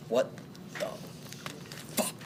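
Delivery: word-by-word pronouncement, long pauses; lightly acted stern king, spacing out each word; deep-voiced king. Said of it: "like, deep voice".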